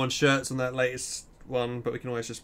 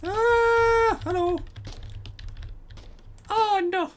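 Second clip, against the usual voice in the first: surprised yelp; much slower than usual. Real, not acted. Sudden surprise in game.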